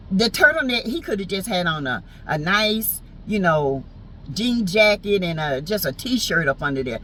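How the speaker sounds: ordinary talk, nothing marked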